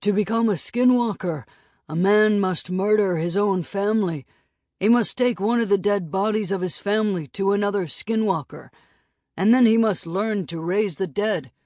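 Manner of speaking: lightly voiced, a grave old teller of dark legends; solemn storyteller Red Moon. A narrator's reading. Red Moon telling the boys the skinwalker legend, solemn and ominous.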